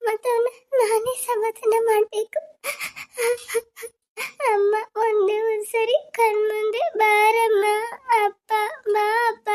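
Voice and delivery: high, soft, slow weeping; soft crying. Acted. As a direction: hush your sobs so the brother won't hear. Child weeping softly for parents.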